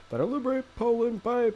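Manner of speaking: high-pitched silly chat-reading voice; weird voice. Pretend. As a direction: read the message in a high, odd voice. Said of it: "say what you say in a, a weird voice".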